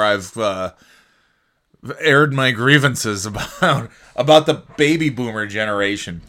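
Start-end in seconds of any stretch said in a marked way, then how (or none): none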